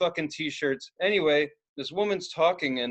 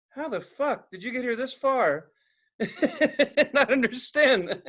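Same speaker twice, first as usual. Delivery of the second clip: higher, slow, laughing through it; laughing disbelief; 8 semitones higher, slower than usual. Retold or imitated. Asking the shop owner how she got this far, laughing. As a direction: marvel at her while cracking up.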